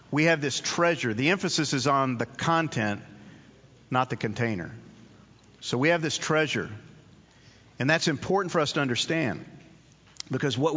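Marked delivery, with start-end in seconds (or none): none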